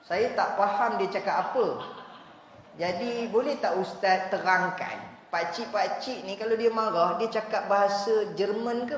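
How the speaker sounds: usual speaking voice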